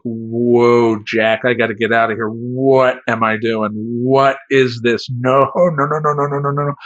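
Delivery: slow, freaked-out no-no-no; panicked. Retold or imitated. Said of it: "I'm like".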